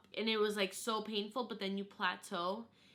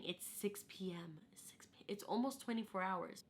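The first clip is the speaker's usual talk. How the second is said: exhausted whisper; 6 dB quieter than usual. Retold or imitated. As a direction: whisper it with nothing left. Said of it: "I look at my midwife, and I'm like".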